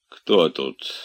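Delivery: plain reading voice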